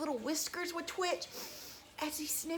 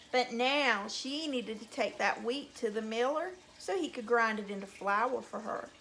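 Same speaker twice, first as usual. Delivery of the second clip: usual speaking voice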